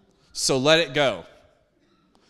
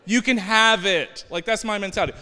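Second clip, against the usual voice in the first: ordinary talk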